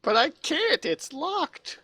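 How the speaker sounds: very high, whiny protest; helpless guard. Acted. Guard protesting to the arbitrator about a lock, high and helpless.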